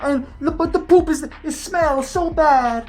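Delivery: high, whiny mock Spanish-English accent; terrible Spanish accent. Maid insisting to Amber that she cleans, terrible Spanish accent. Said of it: "that is a terrible accent".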